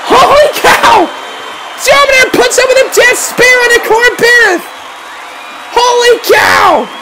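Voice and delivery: high, astonished play-by-play; amazed shouting. Real, not acted. Commentator calling Dominator's Death Spear, amazed shouting. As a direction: holler in sheer amazement.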